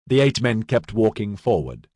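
ordinary delivery, nothing marked

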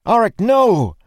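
very high, loud cry; alarmed, drawn-out yell; horrified shout. A narrator's reading. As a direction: scream a warning to someone jumping. Crying out as foe jumps.

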